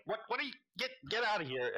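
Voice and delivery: high, sputtering mock shoo; flustered shooing. Game master retelling the hero shooing the girl, high sputtering mock shoo. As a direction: stammer then shoo her off. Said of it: "lawful moment and is like".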